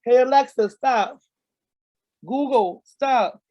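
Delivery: loud, high calls to the speakers; bad acting. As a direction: shout over the ringing phone. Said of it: "I'ma act out a scene".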